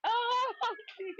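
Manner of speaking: squeaky high open; high coaxing. Pretend. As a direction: coax baby with a tiny high voice. Coaxing baby, laughing.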